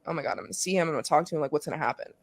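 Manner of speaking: low, very soft inner monologue; hushed anxious thought. Retold or imitated. Player about to see her dad, hushed inner worry. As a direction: run through the worry under your breath.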